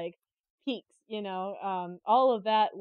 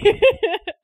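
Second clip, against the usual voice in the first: high, loud delighted laugh; a sudden peal of real laughter; loud burst of laughter; 12 semitones higher, 15 dB louder than usual. Real, not acted. Delighted at the stolen-painting story.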